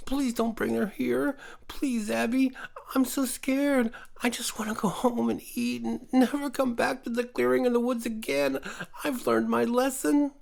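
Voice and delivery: high, pleading creature voice; high-pitched frightened woodland creature begging; pleading scared creature. A narrator's reading. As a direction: beg Abby, scared and tearful. Teddy begs Abby, scared.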